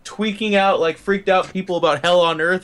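ordinary talk, nothing marked

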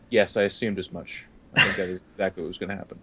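weak after vomiting. Acted.

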